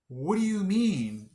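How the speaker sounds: incredulous protest. Retold or imitated. Patient retelling her reaction to front desk charges, incredulous protest. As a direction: protest the surprise bill in a high, stretched voice.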